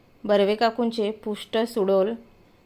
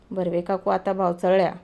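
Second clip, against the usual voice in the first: ordinary delivery, nothing marked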